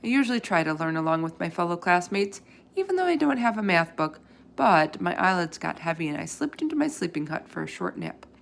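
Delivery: plain reading voice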